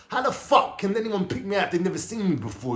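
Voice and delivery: high, incredulous protest; disbelieving outburst. Retold or imitated. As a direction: pitch jumps high in stunned disbelief. Shocked at being picked out.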